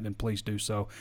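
ordinary delivery

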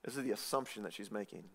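usual speaking voice

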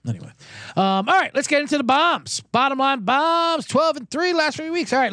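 normal talking voice

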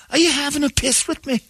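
high, indignant Scottish challenge; a Glasgow man squaring up, mock offended; Scottish accent. Scottish fan confronting [name] at the game, in a high indignant Scottish accent. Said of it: "[name] goes".

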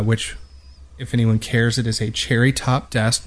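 ordinary talk, nothing marked

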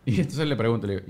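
normal talking voice

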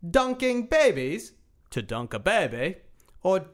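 slow, exaggerated Australian accent. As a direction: read it like a hammy Aussie, swooping high. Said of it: "Read the question in Australian accent".

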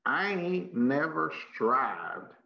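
slow, full Texas drawl; Texas accent. Shown as a demonstration. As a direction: lean all the way into the Texas accent. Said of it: "It's my full Texas voice there".

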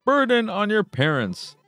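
robot voice. Acted. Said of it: "I should do a robotic voice".

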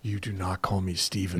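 low, hushed warning; quiet stern dad. Retold or imitated. Dad correcting son who used his first name, quietly stern. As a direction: drop very soft and low to warn him.

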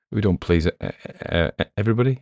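plain reading voice

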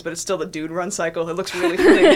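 laughing while talking. Real, not acted. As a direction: giggle through the words. Laughing at funny game.

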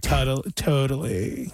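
slow, low creaky drawl; vocal fry. Shown as a demonstration. Host mimicking vocal fry speakers, drawn-out fry. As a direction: stretch it out in throaty fry. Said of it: "vocal fry where everything is".

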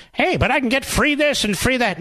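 high, whiny put-on voice of a freeloader; sneering impression of a voter wanting free stuff; mock voter voice. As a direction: pitch it way up and whine like a greedy voter.

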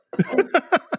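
high laughing burst. Real, not acted. Host breaking up at his accent bit, real laugh. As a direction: crack up mid-bio.

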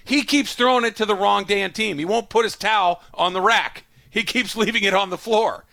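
a fed-up coach unloading in the presser; exasperated coach rant. Retold or imitated. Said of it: "they say, Hey, Coach, what happened today".

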